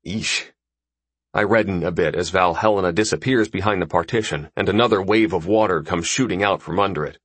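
ordinary delivery, nothing marked

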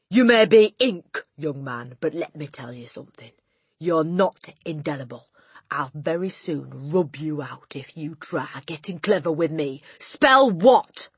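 menacing, with loud bursts; Trunchbull. A narrator's reading. Headmistress warning the cheeky boy, menacing and loud. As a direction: warn him, then shout the final order.

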